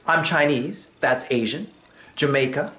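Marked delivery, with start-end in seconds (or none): none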